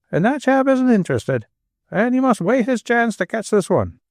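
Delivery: high, fast, breaking tirade; furious Manchester rant. A narrator's reading. Father raging to Anne about millionaires, voice breaking. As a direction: pour out the grievance until the voice cracks. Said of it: "his voice break roughly".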